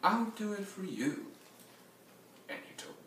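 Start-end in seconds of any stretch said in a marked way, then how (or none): 0.0-1.3 high-pitched smug cat voice; smug purr. Acted. Tabby on the fence to the rooster, smug high voice. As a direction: purr it with superior ease. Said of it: "he purred".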